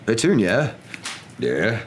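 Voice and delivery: halting, tentative question with pauses; nervous husband. Acted. Husband asking wife about her sister, nervous and halting. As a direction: broach it carefully, hesitating. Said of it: "He cleared his throat nervously".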